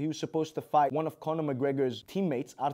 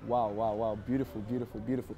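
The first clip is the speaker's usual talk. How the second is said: low, soft, lingering mock flirt; 7 semitones lower, 5 dB quieter than usual. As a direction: purr the compliments teasingly. Flirting with correspondent.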